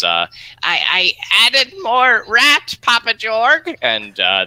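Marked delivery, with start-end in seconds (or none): 0.6-3.8 very high, slow squeaky goblin voice. Acted. Goblin confessing to the orc chef, squeaky goblin voice. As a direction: squeak it out like a little goblin underling. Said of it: "the goblin says".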